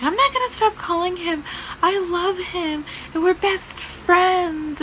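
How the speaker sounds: mock-hysterical high voice; upset girl caller. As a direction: imitate a distraught girl protesting. Upset caller refuses to stop calling.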